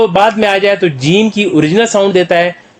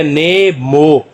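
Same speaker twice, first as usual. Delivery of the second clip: "ने मो" very slow, long vowels; syllable by syllable; 3 semitones lower, much slower than usual. Shown as a demonstration. Teacher blending letters for learners, "ने मो" slowly sounded out. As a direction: stretch "ने", then "मो", with a gap. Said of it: "तो क्या बन गया".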